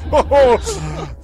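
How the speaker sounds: loud maniacal cackle; mad laugh. Acted. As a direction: cackle in triumph.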